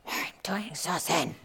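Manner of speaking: whispered self-satisfied thought; smug whisper. Acted. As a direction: hush it like a proud secret. Voicing Seppo's smug thought.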